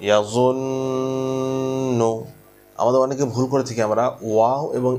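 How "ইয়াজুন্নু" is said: ইয়াজুন্নু with the ন্ন held long through the nose; nasal hold demo. Shown as a demonstration. Teacher demonstrating ghunnah on the doubled nun to students. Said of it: "এক আলিফ গুণ বার করার নাম হচ্ছে গুণনা".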